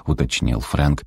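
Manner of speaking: ordinary delivery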